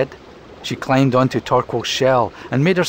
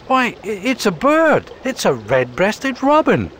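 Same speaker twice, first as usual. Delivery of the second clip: high, surprised tortoise voice; Torquil the tortoise; 10 semitones higher than usual. Acted. Tortoise discovering a robin in the feathers, high and surprised. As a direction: spot the bird and point it out. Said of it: "Torquil noted".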